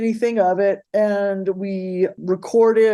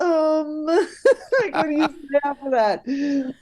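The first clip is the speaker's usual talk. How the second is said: laughing helplessly; 8 semitones higher, slower than usual. Real, not acted. Laughing, lost for words.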